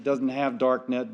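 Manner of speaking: ordinary talk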